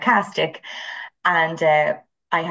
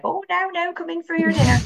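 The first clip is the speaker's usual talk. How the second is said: high, singsong mock-scolding mammy voice; Irish mammy; 13 semitones higher than usual. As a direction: take off an Irish mammy calling the family in. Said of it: "stereotypical Irish mammy".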